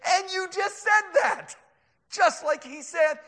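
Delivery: high, loud, slow shout; triumphant excitement. Acted. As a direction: crow it at the scoffer, drawn out. Triumphant acted reply to scoffer.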